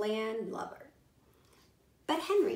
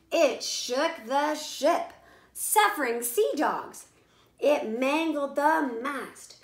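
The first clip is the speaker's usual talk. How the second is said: slow, loud, higher storm narration; dramatic storm telling; 3 semitones higher, 5 dB louder than usual. A narrator's reading. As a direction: build the hurricane word by word.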